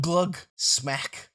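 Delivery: plain reading voice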